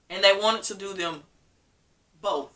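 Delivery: ordinary talk, nothing marked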